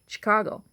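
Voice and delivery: "Chicago" as shi-KAH-go; correct pronunciation. Shown as a demonstration. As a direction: say "Chicago" plainly with a quick, reduced first syllable. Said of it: "It's that simple".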